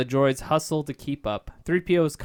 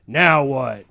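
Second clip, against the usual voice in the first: barking; 7 dB louder than usual. Acted. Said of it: "he barks".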